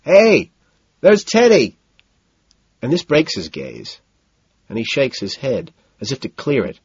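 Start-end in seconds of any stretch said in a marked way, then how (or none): 0.0-1.8 high, loud call to a friend; shouted to get his attention. A narrator's reading. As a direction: holler it across a noisy club. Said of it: "But I shout to him".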